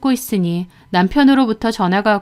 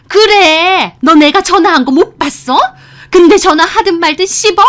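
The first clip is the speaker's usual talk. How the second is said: loud, high furious comeback; angry yelling; 9 semitones higher, 7 dB louder than usual. A narrator's reading. Mother-in-law scolding over ignored calls, angry yelling. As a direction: yell accusingly, pitched right up. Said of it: "소리를 지르고".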